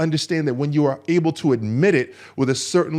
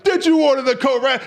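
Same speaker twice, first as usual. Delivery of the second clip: much higher, louder shouted demand; courtroom interrogator; 12 semitones higher, 6 dB louder than usual. Retold or imitated. Questioner demanding an answer from Colonel Jessup, escalating shout. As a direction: press harder, yelling the accusation. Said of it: "like in A Few Good Men".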